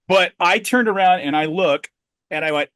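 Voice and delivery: ordinary delivery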